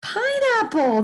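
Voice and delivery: teacher celebrating the answer; excited answer. Shown as a demonstration. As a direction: announce Pineapple with delight. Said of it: "That's right".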